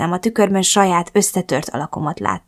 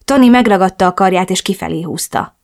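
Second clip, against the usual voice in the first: ordinary delivery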